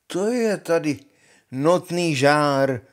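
Honza zvesela a nahlas, na konci pomalu; natáhni „notný žár“ pomalu a hlasitě; hloupý Honza. Acted. Honza rides in boldly. (Honza vjíždí a hlučně komentuje horko)

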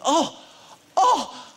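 high, loud, hammed-up "oh"s of a fan; mock excited gasps. Little old man spots the film crew, hammed-up excitement. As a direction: imitate a starstruck old man working himself up. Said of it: "he starts getting very excited".

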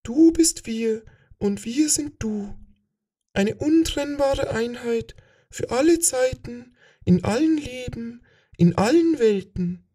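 hohes, langsames Flüstern einer fremden Macht; fremdes Wesen. Acted. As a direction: wie ein körperloses Kollektiv aus dem Nebel flüstern. Das Fremde antwortet. (hohe, langsame, geflüsterte Stimme eines fremden Wesens)

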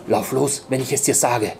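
usual speaking voice

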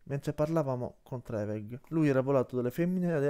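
ordinary delivery

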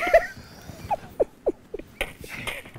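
long, high wheezing laugh; laughing fit. Real, not acted. Presenter cracking up at Eric's comment, long laugh. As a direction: crack up helplessly, high and breathy.

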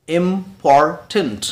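"इंपॉर्टेंट" slowly as IM, POR, TENT; three separated beats on "इंपॉर्टेंट"; split into syllables. Shown as a demonstration. Teacher shows learners three syllables, sounded out slowly. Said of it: "break करते हैं, तोड़ते हैं".

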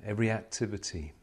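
usual speaking voice

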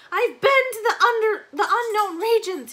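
high, loud, anguished outcry; frantic high wail. A narrator's reading. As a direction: wail it out like a man gone mad. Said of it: "In a high, rapid voice, he wailed out".

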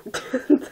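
short giggle; amused snort of laughter. Real, not acted. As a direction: burst into a giggle. Giggles at the text.

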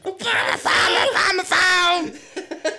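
high, slow, shouted chant; Obama phone lady. Retold or imitated. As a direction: belt it out like a meme. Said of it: "You sound like Obama phone lady".

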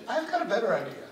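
ordinary talk, nothing marked

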